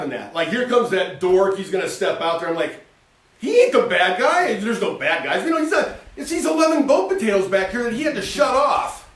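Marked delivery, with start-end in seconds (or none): 3.4-9.1 raised, loud, almost shouting; heated defense. Retold or imitated. Host passionately defending Peter to his cohost, raised shout. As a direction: stand up loudly for Peter.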